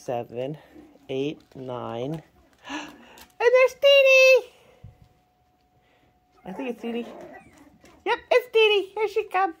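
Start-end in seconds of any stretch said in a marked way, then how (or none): 3.4-4.5 high, loud delighted cry; excited squeal. Real, not acted. Owner spotting her puppy, excited squeal. 8.0-9.5 high, bright announcing of the puppy; excited. Real, not acted. Owner watching puppy arrive, high excited call.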